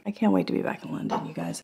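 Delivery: soft, quick mock British accent. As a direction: try on a British accent under your breath. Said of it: "I wish I had a British accent".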